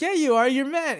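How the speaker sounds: high, loud contradiction; mock insisting. Inuyasha arguing with Kagome, loudly insisting in imitation. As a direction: press the accusation, hammed-up.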